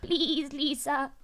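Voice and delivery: high, bleating plea; lamb's voice. Acted. Pleading with Lisa in jest, lamb's voice. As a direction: beg like a bleating lamb. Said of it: "talk with a lamb's voice".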